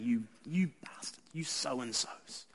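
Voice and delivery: unvoiced whisper of disgust; hushed whisper under the breath. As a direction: breathe the curse out, barely aloud. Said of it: "I just had to say them under my breath".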